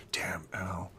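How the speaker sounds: low, hushed mild swearing; muttered curse. Acted. Spooked bandits cursing at the owl, hushed mutter. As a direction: curse softly under your breath. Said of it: "They're, like, cursing".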